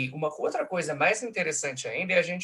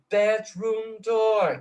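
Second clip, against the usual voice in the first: "bedroom door" high, very slow, three equal weights; hovering long syllables; 7 semitones higher, 5 dB louder, much slower than usual. Shown as a demonstration. Lecturer showing three hovering syllables, high drawn-out "bedroom door". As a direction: let "bedroom door" hang in the air. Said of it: "essas três sílabas pairam no ar".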